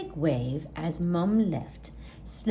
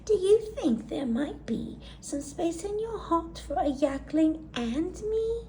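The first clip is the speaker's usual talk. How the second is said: little yak voice; 8 semitones higher than usual. Acted. Gertie asks Mummy.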